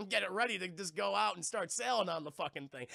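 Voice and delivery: ordinary talk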